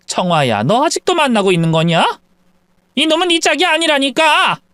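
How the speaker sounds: rising, exasperated scold; exasperated mother. Acted. Mother confronts daughter still dating him, exasperated.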